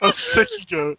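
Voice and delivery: laughing. Real, not acted. Laughing goat comparison.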